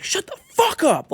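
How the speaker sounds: high, slow, loud snap of the curse; retold yell. Telling the rude passenger to shut up, loud high yell. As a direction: bark it at him through the seat. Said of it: "like that".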